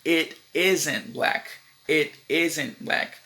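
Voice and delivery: very slow, "isn't" drawn out; slow contraction demo. Shown as a demonstration. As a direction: show the other short form word by word. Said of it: "O outro contraction".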